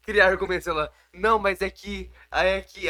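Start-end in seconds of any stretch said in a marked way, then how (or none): 1.1-2.9 stammering. Retold or imitated. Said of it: "aí ela começou a gaguejar".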